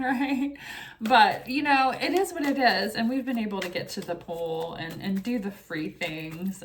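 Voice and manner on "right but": ordinary delivery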